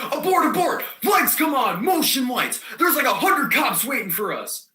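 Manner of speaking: high, urgent shouting; panicked alarm. Acted. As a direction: call off the mission in a panic. Acts out panicked alarm to friends.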